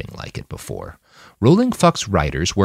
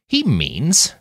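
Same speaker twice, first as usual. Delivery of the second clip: higher voice with a bit of slur; slightly drunken slur; 7 semitones higher than usual. A narrator's reading. Nanayazi explains Donald's view to Manny, slightly drunken slur. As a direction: slur it a little, tipsily. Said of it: "with a bit of drunken slur to her voice".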